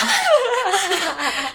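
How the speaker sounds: loud, high laugh at the absurd question; burst of laughter. Real, not acted. Retelling a teacher's Tourette's question to friends, loud high laugh. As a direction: break out laughing.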